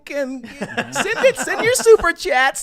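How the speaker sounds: very high, loud, slow squeal; high-pitched screeching whine. Pretend. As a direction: shout the plug in a silly squeal. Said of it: "I'm gonna talk like this".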